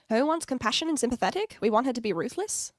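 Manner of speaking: ordinary delivery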